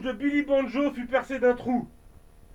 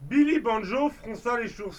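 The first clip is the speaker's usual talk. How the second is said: ordinary delivery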